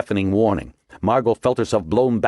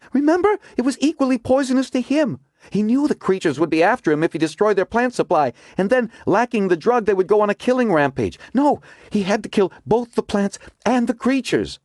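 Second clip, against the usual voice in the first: higher, urgent woman character; Margot's voice. A narrator's reading. Margot explains her theory to the agents, urgent and higher. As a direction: voice Margot pressing her discovery.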